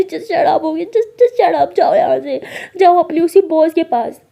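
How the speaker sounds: loud, high, jealous outburst; angry dismissal. Acted. Maya sends her partner off to the boss, angrily shouting.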